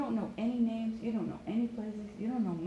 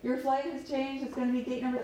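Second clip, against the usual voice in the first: loud, higher-pitched flight announcement; airport announcer; 5 semitones higher, 4 dB louder than usual. Acted. Airport announcing a changed flight once, announcer voice. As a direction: call out a gate change over the tannoy. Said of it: "And they say once".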